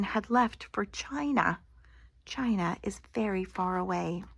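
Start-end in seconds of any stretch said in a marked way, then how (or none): none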